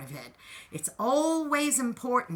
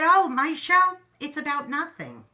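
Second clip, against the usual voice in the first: quoting Jerry Seinfeld; 7 semitones higher, 7 dB louder than usual. Retold or imitated. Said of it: "he said".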